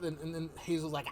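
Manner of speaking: normal talking voice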